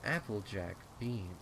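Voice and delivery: plain reading voice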